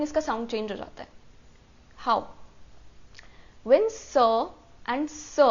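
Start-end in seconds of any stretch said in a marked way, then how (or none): none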